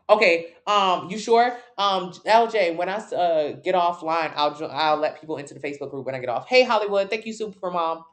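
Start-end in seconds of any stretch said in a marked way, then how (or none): none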